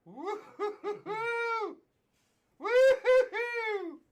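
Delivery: slow, high whooping laugh building to a loud shout. Real, not acted. Streamer cheering a one-of-one pull, laughing loudly. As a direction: cheer and laugh, drawn out and rising to a yell. Rare card pulled live.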